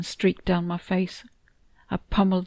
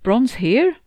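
mildly alarmed question, jumping upward at the end; half-choked panic; 5 semitones higher, 9 dB louder than usual. A narrator's reading. Startled to hear Bron may be present, half panicked. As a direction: blurt it with a touch of panic. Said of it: "I half choked, half panicked".

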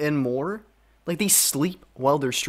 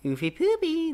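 high, goofy squeak; silly high voice. Real, not acted. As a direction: squeak it out like a cartoon. Silly reaction to Twitch answer.

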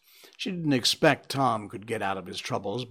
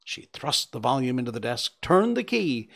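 ordinary delivery

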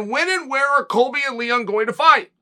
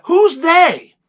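pitched up, loud, demanding who they are; loud challenge; 7 semitones higher, 7 dB louder than usual. Real, not acted. Host to listeners, demanding an answer loudly. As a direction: call them out, loud and pointed.

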